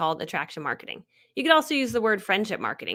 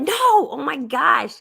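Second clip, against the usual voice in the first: slow, loud, higher gush of disbelief; excited protest; 4 semitones higher, 6 dB louder, much slower than usual. Retold or imitated. Answering a viewer who doubts she can be an authority, excited protest. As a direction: burst out at the doubt.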